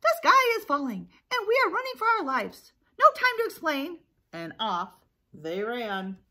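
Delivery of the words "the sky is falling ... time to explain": high, loud alarm cry; hammed-up panic. Chicken Little thinks the sky is falling, panicked cry. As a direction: raise the alarm like a frantic cartoon chick.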